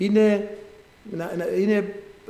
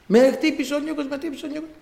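put-on crying child; 9 semitones higher than usual. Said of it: "έρχεται ο Μάρκος κλαίγοντας".

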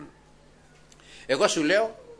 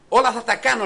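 God declaring; 9 semitones higher, 8 dB louder than usual. Retold or imitated. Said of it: "λέει ο Θεός".

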